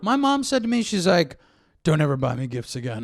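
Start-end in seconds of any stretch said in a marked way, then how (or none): 1.8-3.0 low, laughing Arnold impression. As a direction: growl it like Arnold Schwarzenegger. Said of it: "She said it like that? Like Arnold?".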